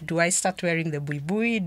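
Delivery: ordinary talk, nothing marked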